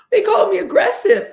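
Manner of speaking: hammed-up offended cry; wounded and indignant. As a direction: play someone stung by being called aggressive. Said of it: "they'll be like".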